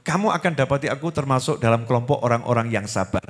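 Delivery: ordinary delivery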